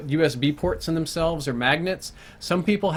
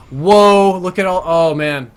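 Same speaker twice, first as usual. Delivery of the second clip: startled whoa; 3 semitones higher, 9 dB louder, slower than usual. Real, not acted. Sudden shock at the screen.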